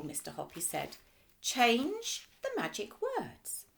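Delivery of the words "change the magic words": slow, careful instruction; slow, deliberate. A narrator's reading. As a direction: spell it out slowly. Hoppy explains slowly.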